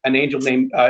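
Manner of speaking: ordinary talk, nothing marked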